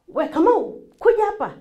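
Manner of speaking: put-on high-pitched summons to a child; commanding. Parent calls child to come, commanding. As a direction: call the child over like an order. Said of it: "you are being commanded".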